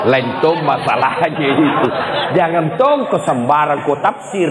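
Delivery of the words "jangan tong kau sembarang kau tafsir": laughing through a mock warning; laughing scold. Real, not acted. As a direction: chuckle while wagging the finger. Joking scolding, laugh heard.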